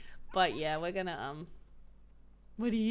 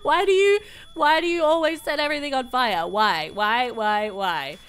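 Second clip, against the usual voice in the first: exasperated shout; 3 semitones higher, 8 dB louder than usual. Real, not acted. Gamer yelling at her sim who set the kitchen on fire, loud and exasperated.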